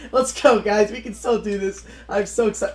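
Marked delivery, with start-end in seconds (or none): none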